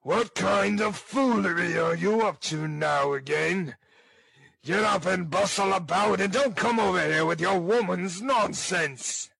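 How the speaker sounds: loud, high-pitched furious tirade; fierce ruffian's shouted scolding; savage voice. Acted. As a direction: berate her savagely as a brutal bully. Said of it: "in a savage voice".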